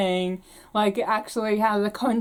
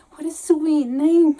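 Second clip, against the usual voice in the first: put-on delighted girl; 6 semitones higher, 5 dB louder, slower than usual. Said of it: "Fanny in greatest delight".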